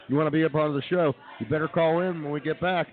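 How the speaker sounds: ordinary talk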